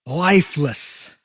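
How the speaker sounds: ordinary delivery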